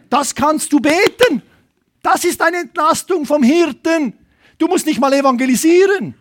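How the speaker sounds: high, forceful exhortation; emphatic preacher's cry; impassioned preaching. Real, not acted. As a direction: drive it home to the congregation.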